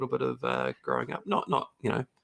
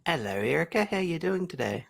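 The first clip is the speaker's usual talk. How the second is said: lightly posh fancy-gentleman voice, pitched higher; a hint of a fancy man accent; 7 semitones higher than usual. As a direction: put on a toff's airs to greet the host. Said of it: "It's my fancy man accent".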